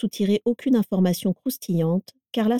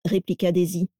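plain reading voice

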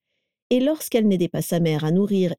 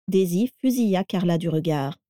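ordinary delivery

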